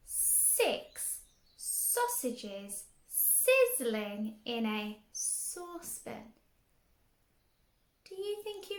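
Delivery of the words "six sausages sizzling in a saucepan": slow, stretched sizzling S sounds; six sausages sizzling with long hissed S; stretched hissing S. Shown as a demonstration. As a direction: drag out every S like sizzling sausages. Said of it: "is a picture of".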